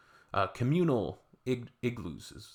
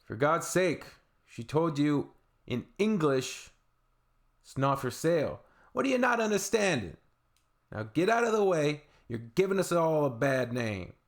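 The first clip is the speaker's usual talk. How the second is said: high, loud, exasperated New Yorker telling someone off; 6 semitones higher, 4 dB louder than usual. Retold or imitated. New Yorker scolding a tourist in a shop, loud New York voice. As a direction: pitch it up and project in indignant New York style. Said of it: "used her best New York loud voice".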